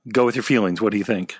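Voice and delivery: ordinary delivery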